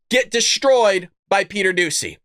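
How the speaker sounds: normal talking voice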